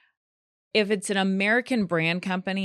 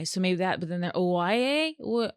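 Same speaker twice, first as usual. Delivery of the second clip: usual speaking voice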